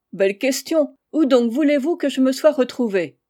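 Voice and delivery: amazed she could even ask that; indignant retort. A narrator's reading. Indignant retort to odd question.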